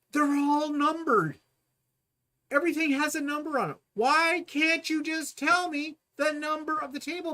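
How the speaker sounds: high, loud, fed-up complaint; exasperated. Real, not acted. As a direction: vent like you can't believe it. Exasperated retelling of confusing directions.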